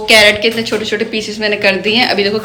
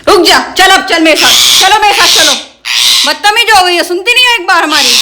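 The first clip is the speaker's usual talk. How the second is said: raised, sharp scolding of a pet parrot; 7 semitones higher than usual. Real, not acted. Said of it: "जब इसे डांट के बुलाती हूँ".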